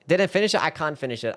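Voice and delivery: ordinary delivery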